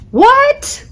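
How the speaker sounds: high, sharp disbelieving what; Uranus hearing Pluto was demoted; shocked squeak. Acted. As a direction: yelp it in pure disbelief.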